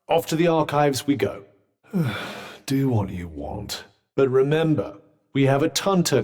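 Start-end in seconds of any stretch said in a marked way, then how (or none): 1.9-3.9 sigh, then give in low and slow; soft, slow, grudging surrender; resigned sigh. Acted. Gives in with a sigh.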